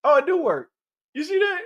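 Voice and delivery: thrilled it works; excited. Real, not acted. Sound effect test succeeds.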